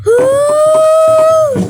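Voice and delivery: high, loud, long ghostly wail; ghost woo. Pretend. Prankster hiding as a ghost wails at the kid, high and loud.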